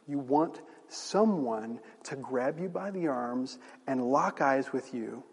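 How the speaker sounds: ordinary talk, nothing marked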